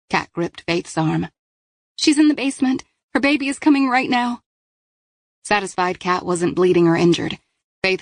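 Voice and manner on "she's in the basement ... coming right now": higher voiced girl, breathless urgency; lightly voiced teen pleading; urgent Kat. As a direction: get help now. Kat urgently tells Faith.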